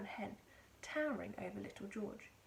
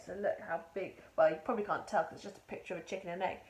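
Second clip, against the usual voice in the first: ordinary delivery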